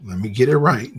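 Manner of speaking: usual speaking voice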